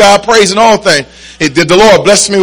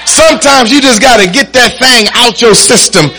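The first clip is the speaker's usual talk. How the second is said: driving exhortation building to a higher shouted peak; fervent preaching; 4 semitones higher than usual. Real, not acted. Preacher urges congregation to cry out, fervent and building. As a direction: drive each phrase home, rousing the congregation. Preaches at rising full force.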